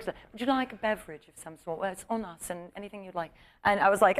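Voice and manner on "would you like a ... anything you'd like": put-on very soft, gracious British accent. Kate offering the prankster a drink, in a soft British accent. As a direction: quietly offer it in polite British tones. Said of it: "she had that British accent".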